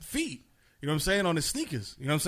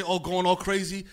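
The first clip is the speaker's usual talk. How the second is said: ordinary delivery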